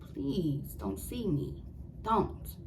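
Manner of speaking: hushed pleading under the breath; scared whisper. Retold or imitated. Hiding from the stalker in the hallway, hushed pleading. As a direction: beg quietly not to be seen.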